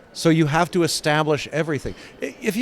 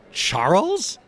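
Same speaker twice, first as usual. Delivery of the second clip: amused knowing higher call of the name; grinning; 5 semitones higher than usual. Retold or imitated. Carol answering Professor X on the phone, grinning. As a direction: say it with a grin, recognizing him. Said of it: "grins and goes".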